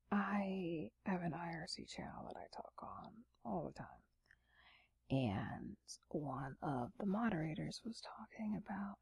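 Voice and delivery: sleepy late-night whisper. Real, not acted. ASMR whisper throughout.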